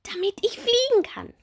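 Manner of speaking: high, bright little bumblebee; small bumblebee voice. Acted. Little bumblebee Bommel asking Marie about her wings, high childlike voice.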